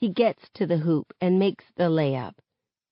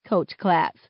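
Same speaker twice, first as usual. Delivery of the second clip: plain reading voice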